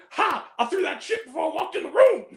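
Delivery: high-pitched movie-character impression; hammed-up retold film line; mock triumphant boast. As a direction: crow it in a put-on high voice.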